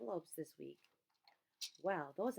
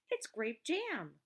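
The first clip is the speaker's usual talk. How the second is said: pleased discovery; 6 semitones higher, 7 dB louder, much slower than usual. A narrator's reading. Ruth Rose grinned.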